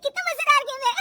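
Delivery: high, loud amused voice; laughing high-pitched delight; giggly delight. Acted. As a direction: gush through giggles. Amused daughter-in-law watching TV.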